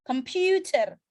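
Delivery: "computer" with a strong, long middle PU; stress on PU. Shown as a demonstration. Teacher asking where stress sits, "computer" stressed on PU. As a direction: put the weight on PU in "computer". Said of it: "Berarti stresnya di".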